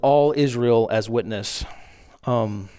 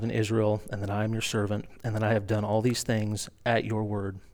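much softer, hushed pleading prayer; earnest prayer; 3 semitones lower, 6 dB quieter than usual. Acted. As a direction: pray softly to the Lord, humbly. Elijah prays at the altar.

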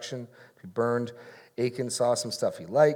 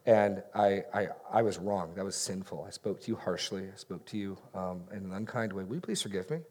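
soft, lower, sincere apology; humble confession; 3 semitones lower, 6 dB quieter than usual. Acted. Husband confessing harsh words to his wife, humble and soft. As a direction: own up quietly and ask forgiveness.

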